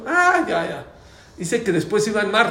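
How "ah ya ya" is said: high, loud "ah" of sudden realisation; acted relief. Scared passengers hear the driver is there, acted relief. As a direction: imitate passengers suddenly reassured.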